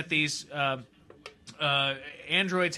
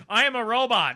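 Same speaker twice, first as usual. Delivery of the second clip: higher mock robot announcement; 5 semitones higher, 4 dB louder than usual. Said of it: "she just comes out and says".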